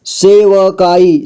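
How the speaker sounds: सेवकाई slowly, with व sounded in full; syllable by syllable. Shown as a demonstration. Teacher breaking down सेवकाई for learners, slowly syllable by syllable. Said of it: "जोड़ जोड़ करके".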